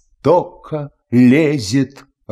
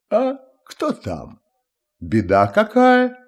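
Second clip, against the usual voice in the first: high, mildly yawny questions; sleepy tsar asking, pitched high; yawning; 10 semitones higher than usual. A narrator's reading. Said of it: "зевая". (сонно, будто зевая)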